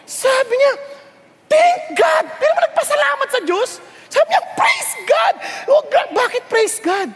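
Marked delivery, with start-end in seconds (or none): 1.5-2.3 elated thanks. Acted. Prisoner thanks God on finding Bible. 4.5-5.3 joyful shout. Acted. Prisoner praises God, shouting.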